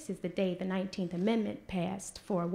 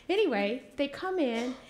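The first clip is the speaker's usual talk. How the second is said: normal talking voice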